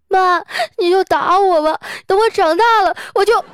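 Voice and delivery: high, loud mock bawling; crying defiantly. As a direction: wail a vengeful threat like a slapped boy. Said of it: "一边哭啊，一边恶狠狠地说".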